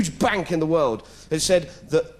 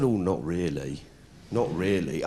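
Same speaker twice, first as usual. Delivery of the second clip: evasive mumble; 10 semitones lower, 5 dB quieter than usual. Acted. Press officer evasive.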